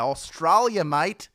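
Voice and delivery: loud, hammed-up Australian accent; terrible Australian accent. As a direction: put on a bad Aussie accent. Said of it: "That was a terrible accent".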